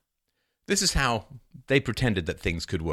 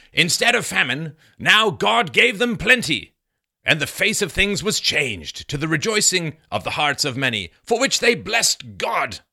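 loud, higher-pitched declamation; declaimed historical quote; 6 semitones higher, 6 dB louder than usual. Acted. Reading Bradford's account to listeners, loudly declaimed. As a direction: proclaim it slowly and grandly.

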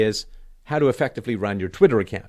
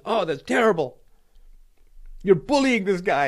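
mock outrage; 9 semitones higher than usual. Imitating angry followers scolding the host for bullying, mock outrage. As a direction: put on exaggerated, high-pitched indignation. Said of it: "people got really mad at me".

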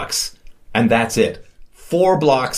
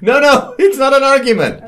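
laughing protest; 11 semitones higher, 5 dB louder than usual. Real, not acted. Laughing protest at friendly fire.

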